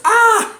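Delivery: high, loud squeal of joy; excited squeal. Real, not acted. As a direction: squeal with delight. Celebrating ten thousand followers.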